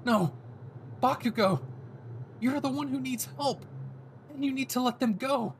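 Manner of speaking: frightened hero pleading; alarmed Deku. Acted. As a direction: shout him down in shock.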